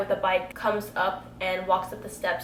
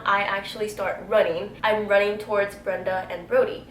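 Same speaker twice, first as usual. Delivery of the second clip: ordinary talk, nothing marked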